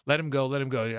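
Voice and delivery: ordinary talk, nothing marked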